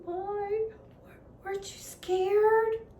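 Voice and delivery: put-on a small girl under the covers; lightly voiced small Laura, whispering under the covers; little girl whispering. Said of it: "whispered to Alice".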